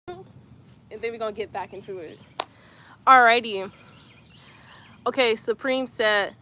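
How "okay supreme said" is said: ordinary talk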